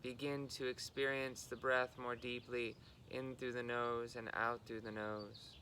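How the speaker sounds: unhurried guidance, level in pitch, pausing between phrases; guided meditation. Real, not acted. Guiding listeners into body and breath, calm meditation delivery.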